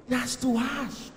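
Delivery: drawn-out mock reproach; mock offended. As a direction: stretch it out, wounded by the accusation. Man protests at word "cheated.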